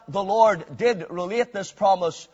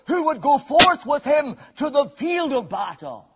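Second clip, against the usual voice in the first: raised, higher pulpit voice in rolling phrases; impassioned preaching; 5 semitones higher, 6 dB louder than usual. Real, not acted. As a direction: build the list with fervour for the congregation. Preaching at full force.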